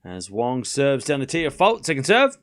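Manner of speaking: live tennis commentary, rising high on big points; play-by-play call. Acted. Streamer calling the tiebreak for chat, live play-by-play. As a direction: narrate every shot as it lands.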